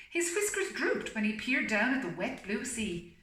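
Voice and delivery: steady storybook reading voice; story narration. A narrator's reading. Reading the story aloud to a listening pet, storybook voice. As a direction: read it like a bedtime picture book.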